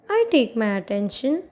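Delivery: normal talking voice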